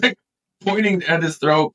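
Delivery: normal talking voice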